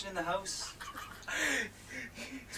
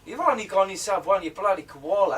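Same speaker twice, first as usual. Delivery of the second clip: louder copycat Aussie attempt; mock-Aussie, echoing the line; Australian accent; 2 semitones higher, 7 dB louder than usual. Shown as a demonstration. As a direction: have a shaky go at Australian.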